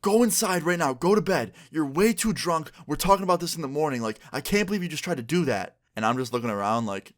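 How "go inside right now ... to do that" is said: high, raised voice ordering mom to bed; scolding son. Retold or imitated. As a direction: son sends his drunk mom inside, pitched up.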